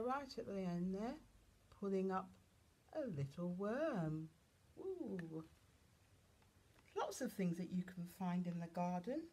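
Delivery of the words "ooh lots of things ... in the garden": usual speaking voice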